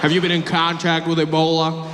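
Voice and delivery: lightly voiced official, casually asking; airport questioner. Airport official to arriving African, mildly chatty screening question. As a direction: ask as if about a distant relative. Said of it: "they always said it like Ebola was, like, a distant relative".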